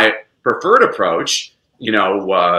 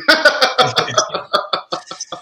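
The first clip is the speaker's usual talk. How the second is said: long string of chuckles; real, trailing laugh; 12 semitones higher than usual. Real, not acted. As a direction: laugh at your own joke. Laughs at own skywritten joke.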